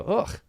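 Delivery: high, soft groan of disgust; a small acted noise of revulsion; disgusted "ugh". Walking past passengers after farting, feigned disgust. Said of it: "I walk by and I go".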